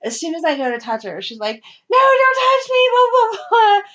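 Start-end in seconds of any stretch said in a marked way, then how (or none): 1.9-3.8 retold child's yell. Daughter protesting being touched.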